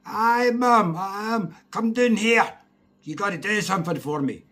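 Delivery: high, loud call from downstairs; mum calling. Retold or imitated. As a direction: holler for your kid to come down. Mother calls child downstairs.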